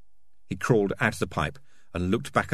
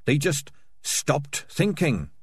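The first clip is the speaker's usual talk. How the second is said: slow, higher-pitched bewilderment; dejected mumble; 7 semitones higher, much slower than usual. Acted. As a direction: say it slowly, at a loss. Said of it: "mumbled Dangerous Beans".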